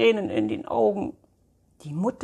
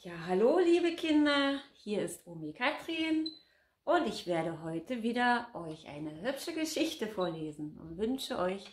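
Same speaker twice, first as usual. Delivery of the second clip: granny voice; 3 semitones higher, 8 dB quieter than usual. Acted. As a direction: greet the kids like a sweet grandma. Oma-Figur begrüßt die Kinder. (Omi Katrin begrüßt die Kinder, hohe leise Omastimme)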